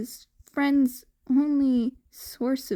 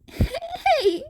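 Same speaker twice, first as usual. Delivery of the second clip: high, giggly start; laughing hey; 3 dB louder than usual. Acted. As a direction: laugh into the word.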